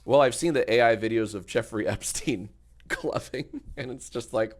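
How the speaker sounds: normal talking voice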